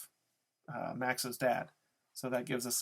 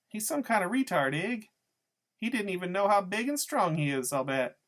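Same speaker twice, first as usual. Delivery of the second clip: taunting woman, high and swooping; Loretta; 7 semitones higher, 5 dB louder than usual. A narrator's reading. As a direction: needle the boys in a loud, high-pitched voice. Narrator voices Loretta taunting the boys.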